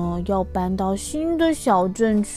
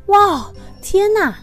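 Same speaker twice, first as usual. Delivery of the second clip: amazed; 10 semitones higher, 5 dB louder, slower than usual. Acted. Amazed at the snowflake.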